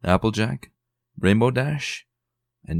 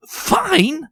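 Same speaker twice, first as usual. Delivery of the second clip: much higher, incredulous rising echo; fussy lady echoing in disbelief; dramatic echo; 5 dB louder than usual. Acted. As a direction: throw the word back, aghast. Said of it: "Rarity echoed dramatically".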